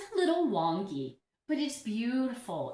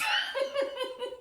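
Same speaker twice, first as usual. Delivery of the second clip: bright high burst of laughter. Real, not acted. Laughing after quoting the commenter to viewers.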